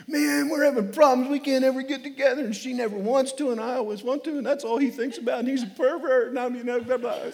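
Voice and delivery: lightly voiced complaining husband; a hint of a frustrated husband; counseled husband. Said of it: "This guy came in and said".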